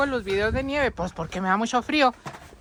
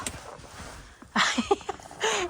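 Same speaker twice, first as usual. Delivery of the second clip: high laugh; 11 semitones higher than usual. Real, not acted. Laughs crawling under the roof.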